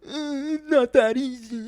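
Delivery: high, loud, drawn-out whine; mocking whine. Customer mocking the clerk's excuse, whiny and hammed-up. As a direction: drag it out in an exaggerated mock whine.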